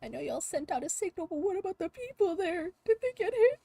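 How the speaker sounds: rising worry. Acted. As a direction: let the worry climb to a cry. Pressing about the villagers.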